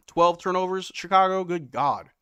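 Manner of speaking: loud exasperated good God. Real, not acted. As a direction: call out the team in disbelief. Outraged at Bulls turnovers.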